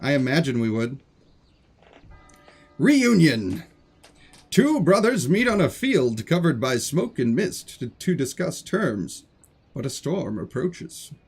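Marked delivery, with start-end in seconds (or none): 2.8-11.1 slow, theatrical storyteller narration; dramatic reading. Acted. Streamer indulging viewers in dramatic reading time, theatrical narration. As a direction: set an epic scene with sweeping, drawn-out phrases.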